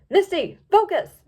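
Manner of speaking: loud, slow scolding snap; snapped. A narrator's reading. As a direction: bark it out to refocus her. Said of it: "snapped Opaline".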